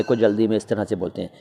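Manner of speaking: ordinary talk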